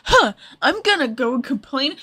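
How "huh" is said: ordinary delivery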